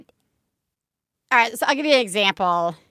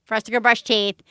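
ordinary delivery

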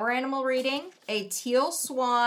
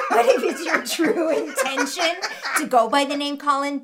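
usual speaking voice

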